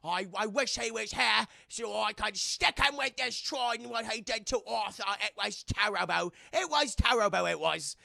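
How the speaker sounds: put-on British accent, overdone; mock British accent. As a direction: imitate a British lady lamenting. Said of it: "Mara still has her British accent".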